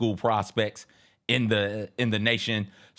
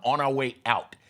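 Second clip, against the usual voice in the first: ordinary delivery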